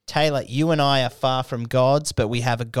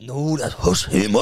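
lightly put-on Japanese accent, bowing; a hint of a Japanese doctor's accent; mock Japanese doctor; 4 semitones higher, 3 dB louder than usual. Friend mocks the doctor's diagnosis with a mock Japanese accent. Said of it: "he bowed at you and went".

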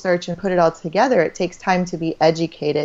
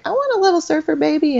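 much higher, sing-song mock of the husband's wish; husband's playful wish; 9 semitones higher, faster than usual. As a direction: pitch it way up like a dreamy kid asking. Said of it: "he's like".

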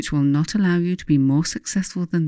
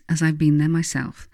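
ordinary talk, nothing marked

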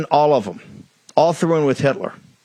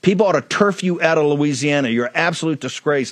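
usual speaking voice

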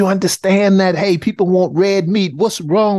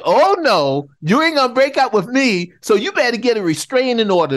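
higher, mock-possessive ex who won't let go; imitating a clingy ex, pitched up; Donald Trump; 7 semitones higher than usual. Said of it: "Donald Trump's like".

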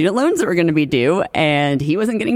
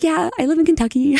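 nervous fan; 13 semitones higher than usual. Retold or imitated. Nervous meeting Brad Pitt.

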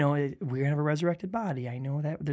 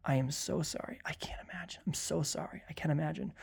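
whispery, tender sympathy; hushed consoling refrain; modelled condolence; 9 dB quieter than usual. Shown as a demonstration. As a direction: console softly, almost in a whisper. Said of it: "but you can always say".

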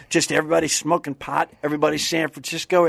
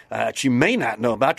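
ordinary talk

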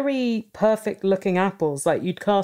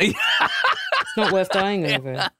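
laughing; 3 semitones higher, much slower than usual. Real, not acted. Teasing, laughing.

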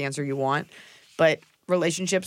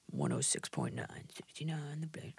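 whispered radio ident; 14 dB quieter than usual. Pretend.

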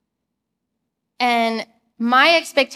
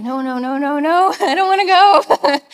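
louder, higher no-no-no breaking into laughter; laughing refusal; 4 semitones higher, 6 dB louder than usual. Retold or imitated. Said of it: "And I'm like".

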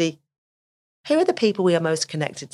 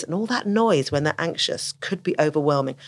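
normal talking voice

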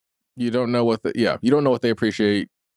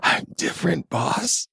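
breathy whisper; 2 semitones higher, 4 dB quieter, slower than usual. Pretend. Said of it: "whispery, breathy voice".

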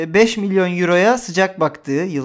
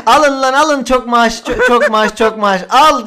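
tossing cash, higher and louder; 5 semitones higher, 6 dB louder than usual. Retold or imitated. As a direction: shout it out like throwing money. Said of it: "diye böyle kesip önümüze atsa ya".

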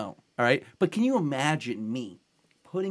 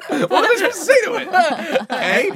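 higher, laughing disbelief; amused protest while laughing; 12 semitones higher, 8 dB louder than usual. Real, not acted. Laughing at own story.

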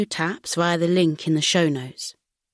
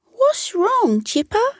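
much higher, small worried animal voice; concerned quokka; slower than usual. Acted. Marcy the quokka asking her sad friend, concerned. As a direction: ask gently, full of concern. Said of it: "asked with concern".

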